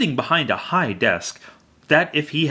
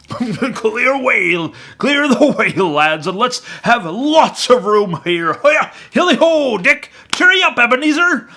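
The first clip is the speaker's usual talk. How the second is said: high, much louder hearty calls; jovial Fezziwig shouting; 11 semitones higher, 7 dB louder than usual. A narrator's reading. Fezziwig clearing room for the dance, merry shouts.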